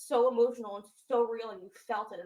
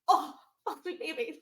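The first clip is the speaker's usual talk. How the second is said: touched laughing aww; 5 semitones higher, 5 dB louder than usual. Real, not acted. Emotional reaction to segment.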